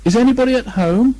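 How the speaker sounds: high, loud call into the hole. Acted. Bear calls down into the rabbit hole, loud. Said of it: "and called out".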